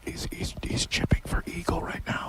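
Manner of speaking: hushed whisper by the green. Real, not acted. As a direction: whisper so the golfer can't hear. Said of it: "Quiet sign is up".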